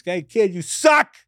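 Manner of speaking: high mock heckle from the crowd; heckler yell. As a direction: yell it at the comic on stage. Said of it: "I just yell".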